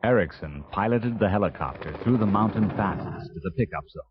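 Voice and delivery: usual speaking voice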